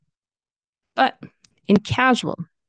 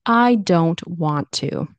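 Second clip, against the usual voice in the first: slow, emphatic refusal; every word separate and firm; aggressive; 4 dB louder, much slower than usual. Shown as a demonstration. Teacher showing how full forms come across, aggressive. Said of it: "that sounds pretty aggressive".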